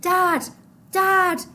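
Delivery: desperate shout for Dad; high, loud frantic cry. Acted. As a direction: call out to a father lost in the dark. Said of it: "Mahmoud cried".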